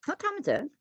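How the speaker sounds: high startled question; suddenly alarmed; surprised. Real, not acted.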